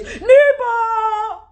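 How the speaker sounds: high, loud outraged cry of Nibo!; shocked Yoruba exclamation, loud and high. Real, not acted. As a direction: burst out in disbelief.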